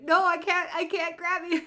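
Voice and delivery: high, loud panicked cry; shocked dream figure. Retold or imitated. Retelling a dream chaser in shock, high loud panic. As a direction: act out helpless shock. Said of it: "they went into shock".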